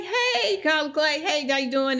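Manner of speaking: high-pitched, delighted hello; excited greeting. Retold or imitated. Acting out how the guest answered her call, high excited greeting. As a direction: imitate a thrilled friend picking up.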